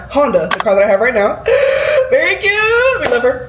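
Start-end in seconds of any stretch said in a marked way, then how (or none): 1.5-2.1 sharp high gasp of delight. Real, not acted. Gasps over her cute car. 2.1-3.5 much higher, gushing praise. Real, not acted. Gushing over her car.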